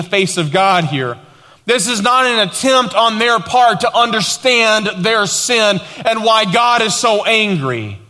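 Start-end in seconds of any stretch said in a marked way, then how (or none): 1.7-8.0 raised, driving sermon voice; emphatic preaching. Real, not acted. Pastor explaining the people's sin, emphatic preaching. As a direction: press the point home from the pulpit.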